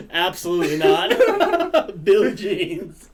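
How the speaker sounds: laughing groan. Real, not acted. Host groaning at a dad joke, laughing. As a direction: drag it out through laughter.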